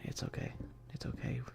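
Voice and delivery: ordinary talk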